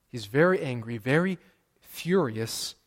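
ordinary talk, nothing marked

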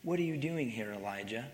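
softly voiced question from God, hushed; gentle whisper. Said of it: "remember, it's a gentle whisper".

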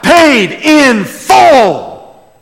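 very slow, high shout; crying out. Acted. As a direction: shout it out, stretching each word. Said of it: "he cried out".

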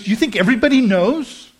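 retold tearful question, understated; crying. Woman asking her pastor, lightly tearful. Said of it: "she started just crying".

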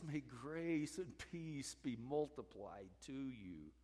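unhurried, gentle greeting of grace; gentle blessing. Retold or imitated. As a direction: offer it softly, without any pushing. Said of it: "he just says".

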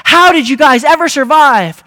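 loud, high, incredulous question; hammed-up reporter's amazement; amazed reporter. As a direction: call it out like an astonished newsman. Reporter voiced in the story.

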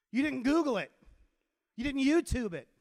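incredulous telling-off, punched out; exasperated teacher. Retold or imitated. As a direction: list each failing emphatically at the student. Teacher scolding a student.

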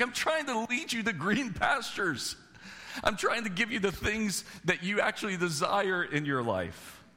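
a lightly voiced, pleading God. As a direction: voice God gently urging his children. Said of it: "And he's like".

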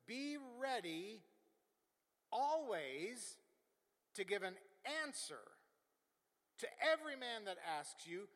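forceful pulpit recitation; emphatic preaching. Acted. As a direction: proclaim the verse in spaced-out phrases.

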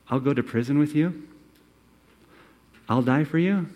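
questioning rise on "prison" and "you", mock-disbelieving; the boast now sounds hollow; ironic echo. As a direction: toss his own promise back at him. Vow echoed back with irony.

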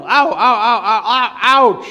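high, loud string of pained yelps; hammed-up yelling, peaking on "ouch"; yelled ows. A narrator's reading. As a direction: yelp in mock pain like a hurt child. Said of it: "Amanda yelled".

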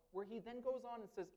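ordinary delivery

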